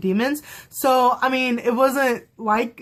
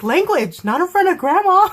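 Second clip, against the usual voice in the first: mock scolding that climbs higher and louder; sassy Jace; 9 semitones higher, 4 dB louder than usual. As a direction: imitate a cheeky telling-off. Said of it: "sassy Jace is my favorite Jace".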